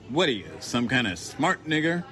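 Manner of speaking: lightly voiced contemptuous cracker; sneering bigot. A narrator's reading. Narrator voices the hostile man.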